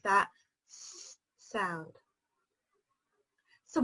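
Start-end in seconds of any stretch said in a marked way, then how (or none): 0.0-1.9 s in s sound held long and slow; slow hissing s. Shown as a demonstration. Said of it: "think about very carefully how we make".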